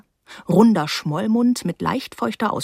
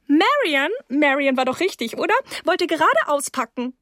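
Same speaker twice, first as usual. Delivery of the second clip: high-pitched hostess voice; 9 semitones higher than usual. Acted. Mrs Rose introduces guest to husband, high hostess voice.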